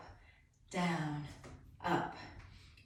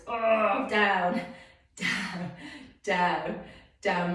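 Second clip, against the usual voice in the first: straining, legs trembling; shaking with effort; 4 dB louder, much slower than usual. Real, not acted. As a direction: push through the burn. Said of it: "My legs are shaking here".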